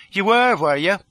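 high, loud, challenging question; sceptical retort. A narrator's reading. Lola doubting the fugitive's story, sceptical. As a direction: throw the claim back at him.

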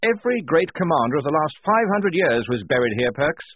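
high, loud, enthusiastic officer; awed excitement. A narrator's reading. Lieutenant marvelling to his corporal, excited. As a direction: tell it with a fan's eagerness. Officer thrilled among buried commanders.

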